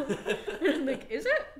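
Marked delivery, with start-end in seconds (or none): 1.1-1.5 high, loud mock question through laughter; mock incredulous. Friend replying to people complaining about interruptions, mock incredulous. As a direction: squeal it in exaggerated disbelief. Acts out response to others' complaint.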